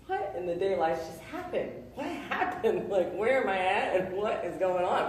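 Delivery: half-laughing at how absurd it was; laughing in disbelief. Retold or imitated. Retelling waking up in hospital.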